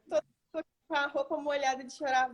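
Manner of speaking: usual speaking voice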